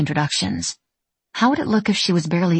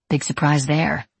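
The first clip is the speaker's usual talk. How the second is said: ordinary delivery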